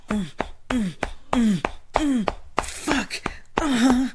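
moan, building to a loud peak. Acted. Building moans to climax.

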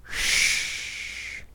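soft breathy shush to calm him; whispered shush. Acted. A dominant lover whispering to calm her partner, hushed.

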